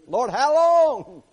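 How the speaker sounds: loud, high, long-drawn plea; psalmist's impatient cry. Acted. Someone needing patience prays, crying out loudly. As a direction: wail the question up to God, slowly. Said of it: "somebody who was needing some patience".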